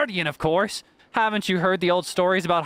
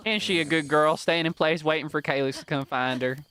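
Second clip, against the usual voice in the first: usual speaking voice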